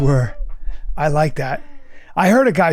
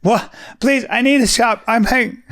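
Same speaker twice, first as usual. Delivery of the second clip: begging employee; 7 semitones higher, 4 dB louder than usual. Pretend.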